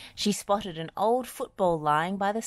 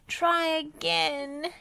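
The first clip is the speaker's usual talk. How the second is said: high, slow cross demand; impatient little snap; 12 semitones higher, much slower than usual. A narrator's reading. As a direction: insist crossly. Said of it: "yelled Dizzy crossly".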